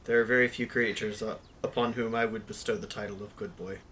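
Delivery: lightly voiced character, soft and measured; a hint of soft, formal character voice. Player as B praises Zach, understated soft character voice. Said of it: "his canon character voice".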